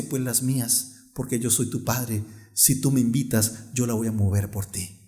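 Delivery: low, soft, tender reply; reassuring father. Retold or imitated. Father reassures struggling son in story. (padre tierno, en voz baja y suave)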